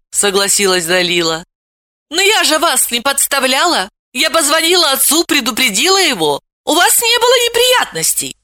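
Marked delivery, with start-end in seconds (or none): 2.1-8.4 put-on higher, earnest girl's voice; young girl defending herself. A narrator's reading. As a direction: insist she did nothing wrong.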